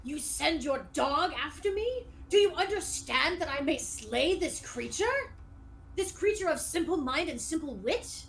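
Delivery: pompous, indignant questioning; affronted skeleton knight. Acted. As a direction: scold the wizard in lofty outrage. Game master voices offended skeleton knight.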